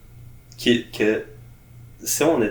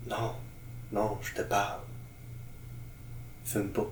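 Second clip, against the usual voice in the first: sensual purr; 15 dB quieter than usual. Pretend. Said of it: "je vous susurre de quoi de ma voix sensuelle".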